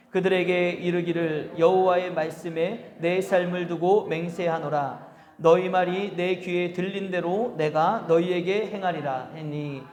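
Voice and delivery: slow, measured congregational reading; scripture reading. Acted. Pastor leading the congregation through a verse, solemn reading. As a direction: read the verse out slowly and solemnly.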